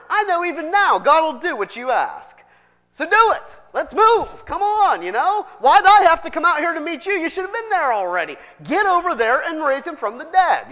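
high, loud nagging demand; impatient Martha. Acted. Martha ordering Jesus to raise her brother, loud nagging. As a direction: push him to hurry up and fix it.

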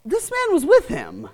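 put-on high accusing servant girl; lightly voiced servant girl accusing Peter. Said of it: "and she's like".

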